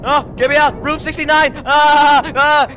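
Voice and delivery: high, loud panicked yelling; terrified screaming. Real, not acted. Player screaming that it's still following, terrified. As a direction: shriek it as the thing gains on you.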